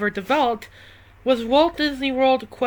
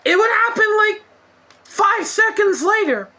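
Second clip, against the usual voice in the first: exasperated rant; 7 semitones higher than usual. Real, not acted. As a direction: vent the frustration.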